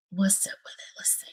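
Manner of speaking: whisper. Real, not acted. Streamer testing her own audio, whispering. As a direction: breathe the question out, no voice. Repeats question under her breath.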